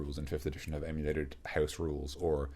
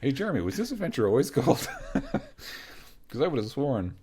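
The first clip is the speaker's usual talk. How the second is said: laughing mock call; 10 semitones higher, 4 dB louder than usual. Playacting a call to Jeremy.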